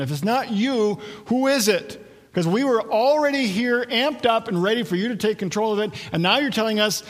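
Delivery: indignant crowd. Acted. Crowd confronting Jesus about who the Son of Man is, indignant. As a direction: challenge him as an agitated crowd.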